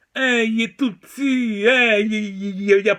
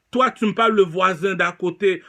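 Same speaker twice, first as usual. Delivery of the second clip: ordinary talk, nothing marked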